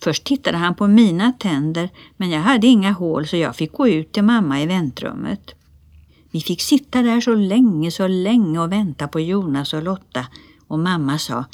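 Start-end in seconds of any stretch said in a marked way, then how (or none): none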